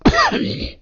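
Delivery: loud, pointed throat-clearing cough; sudden put-on cough; acted cough. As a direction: cough to cover an awkward moment. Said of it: "Bobby kinda coughs".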